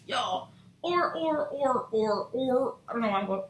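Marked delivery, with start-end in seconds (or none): 0.8-2.7 repeated sea-animal call; imitated animal call. Tarot reader voicing an animal she cannot yet name, animal call. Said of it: "a orca? Oh, an o-, otter?".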